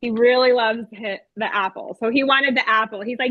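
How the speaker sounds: ordinary delivery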